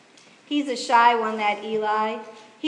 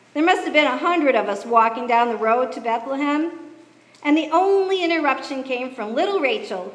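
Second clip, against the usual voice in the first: ordinary delivery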